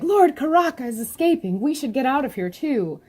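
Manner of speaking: character voice. Acted. Voicing characters from the webtoon.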